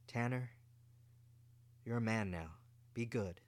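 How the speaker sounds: soft, earnest mother's advice; gentle mother. Acted. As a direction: quietly press it on her son. Mother speaks to son.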